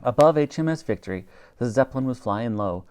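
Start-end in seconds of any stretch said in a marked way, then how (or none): none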